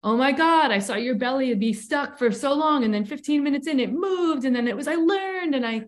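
lightly imitated gushers, voice lifted high; mock gushing. Recounting the circle's compliments to her, gushing.

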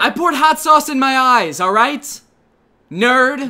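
high, loud annoyed mock retort; hammed-up defensive outburst; defensive snap. Eye-patch guy snaps back.

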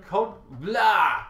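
loud garbled "blah" after a false start; tongue-tied blurt. Real, not acted. Podcaster botching a tongue twister, tongue-tied blurt. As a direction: give up on the word with a loud blah. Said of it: "Tongue twister".

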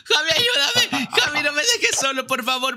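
high, frantic cry for help; panicked pleading. Real, not acted. Gamer under attack begging Javi for help, panicked high plea. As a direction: beg a teammate not to leave you alone. Begs for help fighting the boss.

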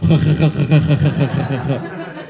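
long staccato throaty "kha-kha-kha" cackle; hammed-up Russian "kha-kha-kha" laugh; Russian doctor's laugh. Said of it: "the weird Russian doctor I had".

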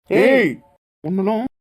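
loud, sudden bark of a warning; angry shout. Acted. Hero shouting down his rival, a furious yell. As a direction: cut him off with a furious yell.

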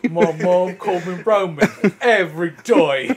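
put-on loud, laughing English-accented rap line; mock English accent. Finishing his friend's rap, mock English accent. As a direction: belt it out in a hammed-up English accent. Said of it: "talking in an English accent".

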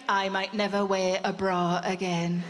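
low, slow, dreamy and floppy; blissed-out sigh. Retold or imitated. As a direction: melt into it like jelly after a massage. Floppy relaxed post-massage bliss.

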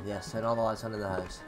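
normal talking voice

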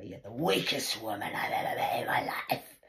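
mock scornful daughter; voicing the daughter's last words; quoting daughter's insult. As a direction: spit the insult as her daughter. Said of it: "her last words to me were".

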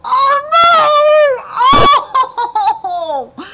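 loud, high drawn-out scream; anguished fan shriek; horrified wail. Real, not acted. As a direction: scream it in dismay at the twist. Horrified at story twist.

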